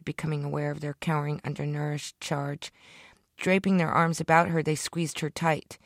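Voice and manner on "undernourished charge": ordinary delivery, nothing marked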